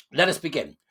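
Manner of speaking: usual speaking voice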